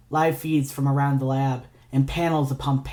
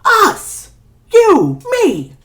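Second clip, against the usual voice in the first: exasperated shout; 11 dB louder, much slower than usual. Acted. Ignatius shouting about their breakup.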